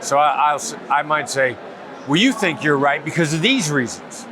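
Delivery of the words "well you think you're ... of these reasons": dismissive, as if talking to someone stupid; belittling, judgmental tone; condescending. Shown as a demonstration. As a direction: let the tone say you think they're wrong. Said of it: "tone of voice tells you that I think you're stupid".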